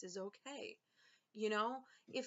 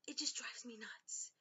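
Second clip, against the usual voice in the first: whispered frustration; 4 semitones higher than usual. Real, not acted. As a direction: whisper it through frustration, unvoiced. Venting frustration to camera.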